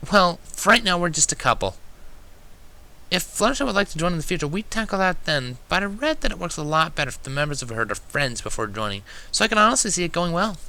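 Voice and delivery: bright lifted mare, explaining; Twilight's voice. Acted.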